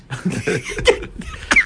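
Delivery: quick breathy burst of laughter at his own joke; high airy laugh. Real, not acted.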